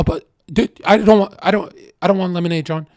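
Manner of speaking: mock flustered panic. Girl at a party panics when he stands, fast and flustered. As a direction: stammer it out like a flustered girl caught off guard. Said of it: "they start freaking out".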